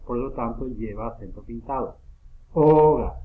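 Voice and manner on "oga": Oga with a loud, high, held O; stress on first syllable. Shown as a demonstration. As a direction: punch the O hard, Oga. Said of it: "suena más fuerte aquí". (Oga con la O inicial más fuerte)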